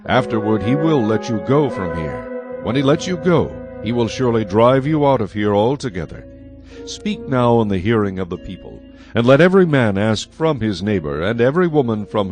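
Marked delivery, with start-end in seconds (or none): none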